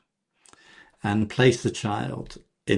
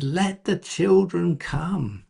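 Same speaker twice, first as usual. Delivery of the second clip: higher, slow insistent call; a firm, raised plea for the children; indignant command; 7 semitones higher, slower than usual. Retold or imitated. Jesus tells disciples let children come, raised and slow. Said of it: "he's very indignant".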